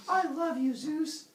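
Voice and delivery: high, hammed-up swoon; swooning girl. Girl declares love to Zeus, high swooning voice. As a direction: gush it high, madly smitten.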